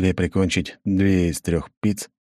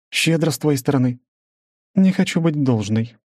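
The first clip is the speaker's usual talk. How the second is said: measured, higher female voice; woman's voice; 8 semitones higher than usual. Acted. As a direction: narrator voicing a businesswoman, higher. Svetlana voiced.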